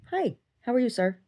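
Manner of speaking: dog greeting. Real, not acted.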